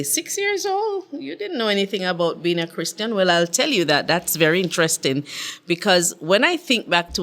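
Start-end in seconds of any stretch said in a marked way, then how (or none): 0.0-3.1 playfully incredulous listener; mock disbelief. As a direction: voice an imagined sceptic's astonishment. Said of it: "Now, you would say".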